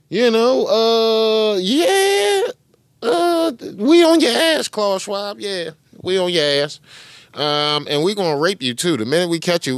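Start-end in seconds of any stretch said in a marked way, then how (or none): 0.1-6.8 high sing-song jeer, far above his usual; mock the target with a gloating lilt; jeering taunt. Real, not acted. Taunting a named public figure.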